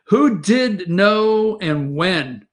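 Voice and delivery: who drawn out slow, each word spaced; slow pointed demand for answers; slow, deliberate question. Real, not acted. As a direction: press the question slowly, word by word. Repeats the demand, rephrased.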